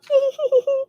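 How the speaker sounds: high little giggle on cue. Shown as a demonstration. Teacher showing the kids a giggle, high and light. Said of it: "I give a little giggle".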